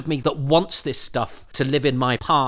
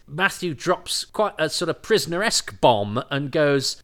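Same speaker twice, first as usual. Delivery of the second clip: normal talking voice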